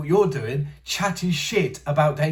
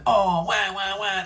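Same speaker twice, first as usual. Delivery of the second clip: higher-pitched mock baby crying; 6 semitones higher, slower than usual. Mocking whining MMA fans with fake baby crying. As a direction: wah it out like a crybaby.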